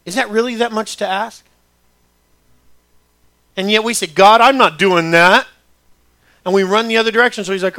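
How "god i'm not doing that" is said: loud, drawn-out mock defiance; defiant refusal. Believer telling God no to his command, loudly defiant. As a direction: dig your heels in, slow and loud.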